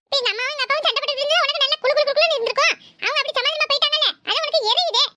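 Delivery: bratty grandson mocking grandpa's acting; cheeky boy. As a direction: needle grandpa like a naughty boy. Boy taunts his grandfather.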